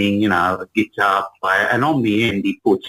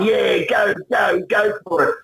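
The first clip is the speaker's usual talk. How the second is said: cheering; 9 semitones higher than usual. Retold or imitated. Classmates cheering from upstairs window.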